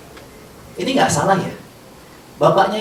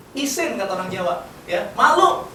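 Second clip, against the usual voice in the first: usual speaking voice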